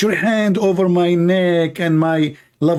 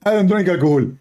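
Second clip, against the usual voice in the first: a lightly voiced, slightly higher preacher denying drinking; mock Zakir Naik; 3 semitones higher than usual. Mocking Zakir Naik denying he drinks, lightly voiced. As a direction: play him indignant, just a hint of put-on. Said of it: "So what, you drunk?".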